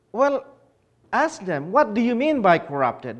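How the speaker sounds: usual speaking voice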